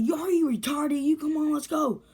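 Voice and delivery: high, loud imitated yell; urgent scolding. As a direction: yell at your buddy to get moving. Friend urging him to run.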